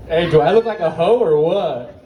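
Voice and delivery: ordinary delivery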